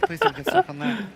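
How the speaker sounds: high, loud desperate plea; pleading cry. Real, not acted. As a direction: beg the game not to stick. Player pleading with game.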